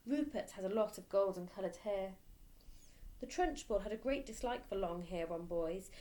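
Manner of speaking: ordinary delivery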